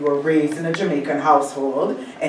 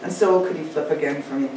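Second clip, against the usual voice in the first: ordinary delivery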